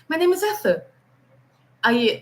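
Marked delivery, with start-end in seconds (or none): 0.1-0.8 higher-pitched British mumble, R dropped; British accent. Retold or imitated. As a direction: imitate an unintelligible Brit swallowing the R. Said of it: "aí ele".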